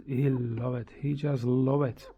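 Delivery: ordinary talk, nothing marked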